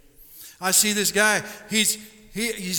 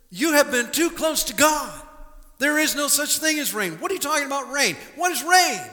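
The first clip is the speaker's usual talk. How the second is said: high, incredulous scoffing; mock him in disbelief, pitched up; scoffing crowd; 6 semitones higher than usual. The crowd ridicules Noah about rain, high incredulous scoff.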